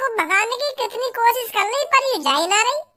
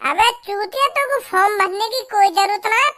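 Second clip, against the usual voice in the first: usual speaking voice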